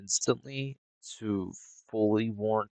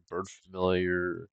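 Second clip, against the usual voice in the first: ordinary talk, nothing marked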